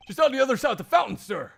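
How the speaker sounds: high, loud, rushed; eager detective voice. Acted. Gumshoe answering the prosecutor, loud and eager. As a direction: blurt it out like an eager cop.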